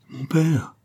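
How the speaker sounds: put-on a young woman's urgent call to her father; crying out. A narrator's reading. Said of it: "s'écria Marie".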